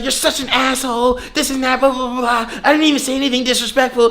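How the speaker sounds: high-pitched mock rant, rattled off without real volume; imitating her yelling. Girl sitting there ranting at him, mock yelling. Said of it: "sitting there yelling at me".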